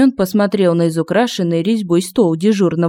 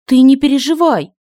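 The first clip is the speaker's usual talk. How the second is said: put-on high, louder girlish comforting; eager reassurance; 7 semitones higher, 5 dB louder than usual. A narrator's reading. Girl rushing to comfort the warrior, high and louder. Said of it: "бросилась успокаивать".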